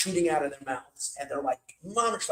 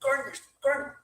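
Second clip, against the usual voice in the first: retold yell; 8 semitones higher than usual. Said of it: "he was telling them".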